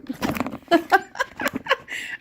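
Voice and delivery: high giggle at herself; real, high-pitched burst of laughter; embarrassed laugh. Real, not acted. Vlogger fumbling the camera, embarrassed laugh. Laughs at her filming mishap.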